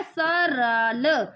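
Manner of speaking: very slow, stretched reading of सरल; teacher stretching each sound; drawn-out blending. Shown as a demonstration. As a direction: sound out सरल slowly for a child. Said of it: "इसमें क्या लिखा है?".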